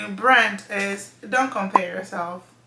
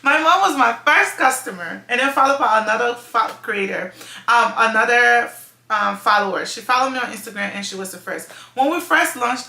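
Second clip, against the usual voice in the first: ordinary talk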